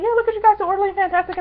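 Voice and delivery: high-pitched mimicry all through; imitating the star. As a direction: voice her compliment up high. Imitates the star admiring fans.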